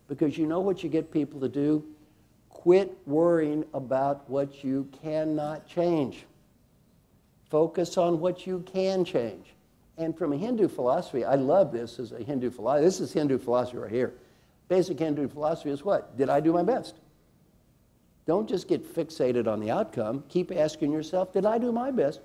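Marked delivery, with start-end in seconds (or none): 2.5-9.5 each word spaced out slowly; measured coaching emphasis; slow, deliberate advice. Shown as a demonstration. Slowed key advice to audience. 21.3-22.2 pitched well up, asking oneself; bright rising self-check; raised questioning voice. Retold or imitated. Acting out inner question.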